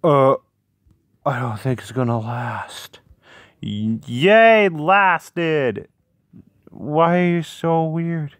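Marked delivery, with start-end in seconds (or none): none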